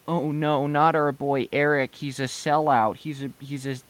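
a lightly hammed-up upset fan; mock whiny fans. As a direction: whine like a betrayed fan. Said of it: "Everyone's like".

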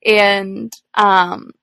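normal talking voice

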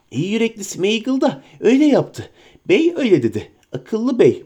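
sly creature whining at its master; Gollum voice. Acted. Gollum continues.

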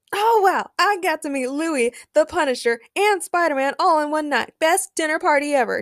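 high, thrilled fan gushing; cheering. A narrator's reading. Danny meets his heroes in one night, cheering. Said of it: "cheered Danny".

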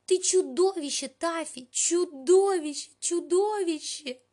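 high, loud anguished howl; wailing. A narrator's reading. Melanie howls at the cat over the torn doll clothes. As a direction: wail the name in despair.

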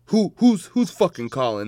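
high, loud, stuttered demand; mock irate. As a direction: challenge the caller in mock annoyance. Acts out challenging the caller.

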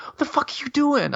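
high incredulous outburst; shocked reps. Retold or imitated. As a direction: voice the reps, way up in disbelief. Reps see liquor being dumped.

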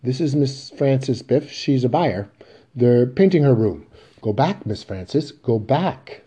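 slow, put-on ordinary tone; pretending nothing is wrong; a strained effort to sound normal; forced casual. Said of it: "suddenly striving for the ordinary".

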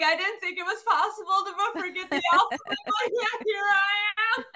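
high-pitched, hysterical wail breaking into laughter; laughing through tears. Retold or imitated. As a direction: re-enact a crying-laughing meltdown. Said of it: "I'm still crying, still laughing".

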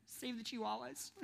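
soft, teary-sounding mock plea, a hint of a whimper; a lightly voiced guilt-tripped viewer, soft; mock soft pleading.

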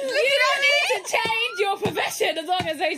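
laughing shout. Real, not acted. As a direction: yell it while laughing. Shouting through laughter at friend.

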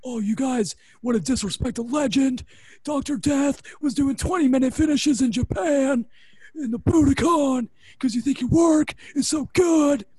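loud, high angry tirade building to a shout; ripping them open. Retold or imitated. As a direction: tear into them like a furious boss. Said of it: "Laurinaitis is ripping him open".

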